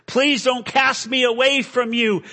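high, loud, desperate plea; crying out. Acted. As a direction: cry out and beg not to be rejected. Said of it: "I cried".

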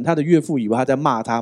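usual speaking voice

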